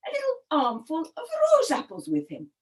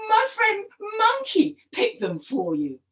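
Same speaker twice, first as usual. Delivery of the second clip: higher-pitched, eager storybook husband; higher voice, falling at the end; explaining crocodile husband; 6 semitones higher than usual. Acted. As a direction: offer it as a plain, happy explanation. Husband proudly explains the gift.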